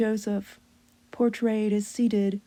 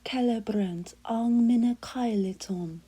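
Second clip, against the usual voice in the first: slow, hushed whisper of a Latin hymn; sung-through church Latin, unhurried; Latin hymn recitation; slower than usual. Acted. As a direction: breathe the Latin line like a prayer.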